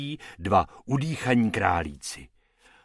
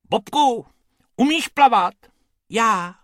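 high, loud worried cartoon rabbits; high-pitched, agitated back-and-forth; little rabbits' voices; 11 semitones higher, 6 dB louder than usual. A narrator's reading. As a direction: squeak it like two anxious small rabbits. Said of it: "Bob s Bobkem zoufale pobíhali po břehu".

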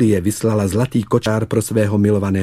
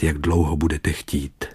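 plain reading voice

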